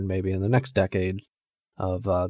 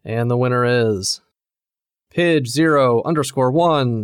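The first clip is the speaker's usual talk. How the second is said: louder, drawn-out winner reveal; award announcer; 4 semitones higher, 7 dB louder than usual. Acted. Host announcing the biggest shitter award, booming. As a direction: build suspense like an awards host.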